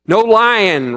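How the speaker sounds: a third voice ringing out loud and high; ringing call. A narrator's reading. A third student calling out a rule, ringing loud. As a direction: call it out over the class. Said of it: "rang out a third voice".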